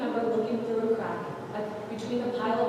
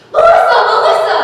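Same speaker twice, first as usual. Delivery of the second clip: panicked calling, much higher and louder; calling her sister; 11 semitones higher, 16 dB louder than usual. A narrator's reading. Narrator losing her sister in the crowd, panicked calling. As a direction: scream her name as she vanishes.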